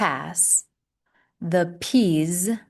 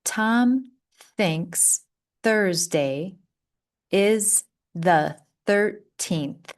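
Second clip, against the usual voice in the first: slow repeat; slower than usual. Shown as a demonstration. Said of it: "Let's slow it down".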